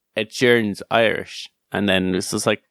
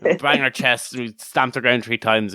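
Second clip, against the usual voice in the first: ordinary talk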